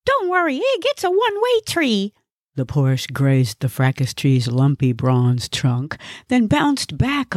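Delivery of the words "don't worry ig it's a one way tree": high-pitched, cocksure character voice; very high comic know-it-all; know-it-all Gnesi. Acted. Gnesi dismisses Ig's worry about his driving, high cocksure voice. Careless driver brushes off warning.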